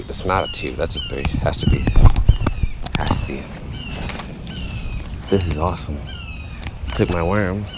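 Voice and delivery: ordinary talk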